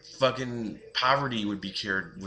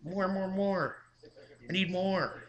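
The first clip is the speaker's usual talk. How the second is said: much higher, softer mock child whine; spoiled kid nagging parents for more toys; whiny greedy kid; 10 semitones higher, 4 dB quieter than usual. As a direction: beg like a spoiled kid who wants it all. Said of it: "And they'll be like".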